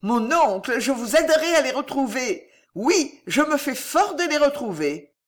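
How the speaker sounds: high, wheedling offer; nephew's wheedling high offer; insinuating. A narrator's reading. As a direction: slide the offer in slyly.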